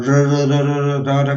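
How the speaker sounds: teacher demonstrating the trill of र; trilled र demo. Shown as a demonstration. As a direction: roll र again and again so the tongue trembles. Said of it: "र का तीन चार बार उच्चारण करोगे".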